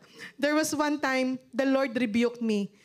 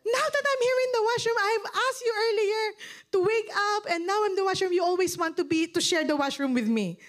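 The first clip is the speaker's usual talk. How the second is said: retold angry nagging; 7 semitones higher than usual. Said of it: "And I was so angry".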